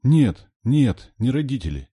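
put-on high, insistent child's squeak; loud, high little-girl protest. A narrator's reading. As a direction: squeak out a frightened denial. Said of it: "пискнула Катя".

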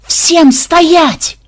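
very high, loud roaring command; roaring at the pursuing riders. A narrator's reading. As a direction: roar to freeze the riders. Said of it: "гордо выпрямился в седле и взревел".

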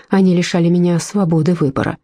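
usual speaking voice

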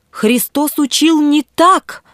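high, slow bishop's protest; fervent exclamation. A narrator's reading. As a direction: exclaim slowly, pitch rising high. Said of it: "воскликнул епископ". (пылко, с возражением)

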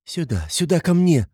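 high, urgent entreaty; pleading. A narrator's reading. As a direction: beg them anxiously to come. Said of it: "умоляла Соня". (умоляет высоким голосом)

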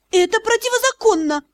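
high indignant protest; indignant outcry. Acted. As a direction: protest with sincere outrage. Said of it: "я искренне возмутилась".

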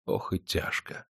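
soft, slow unvoiced lament; whispered sigh. Perstень sighs his heavy thought in a whisper. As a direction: breathe it out in despair.

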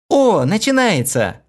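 high, slow excited cry. A narrator's reading. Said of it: "вскрикнула Хлоя".

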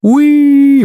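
loud, very high happy squeal; gleeful squeal. A narrator's reading. Goalkeeper creature hopping after the goal, happy squeal. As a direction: squeal loudly with delight. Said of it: "порадовалась Регина".

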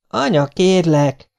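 put-on high, drawn-out boyish plea; könyörgés. A narrator's reading. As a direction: beg your mother slowly. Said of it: "fogta könyörgésre a dolgot Dénes". (magas, elnyújtott könyörgés)

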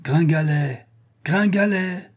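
big calling voice. Acted. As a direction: bellow the boy's name down the ladder. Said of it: "crier d'une grosse voix".

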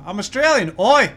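loud, high caricature of an Australian; mock Australian accent. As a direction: call it out in a hammed-up Australian twang. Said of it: "I can't do Australian".

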